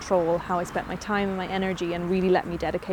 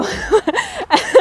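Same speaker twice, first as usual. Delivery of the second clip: loud, high laugh breaking out; burst of laughter; 9 dB louder than usual. Real, not acted. Laughs at her own hardcore rule.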